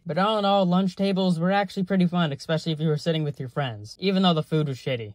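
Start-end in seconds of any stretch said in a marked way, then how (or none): none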